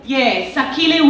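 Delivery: usual speaking voice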